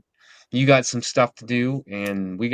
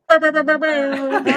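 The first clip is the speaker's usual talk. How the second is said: imitating Sly's hype; 4 dB louder than usual. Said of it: "It's just Sly going".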